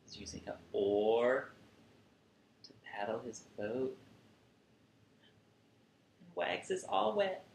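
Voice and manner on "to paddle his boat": ordinary delivery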